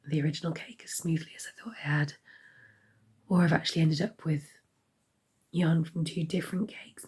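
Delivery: hoarse whisper. Real, not acted. Sick vlogger with failing voice.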